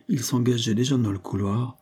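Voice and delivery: usual speaking voice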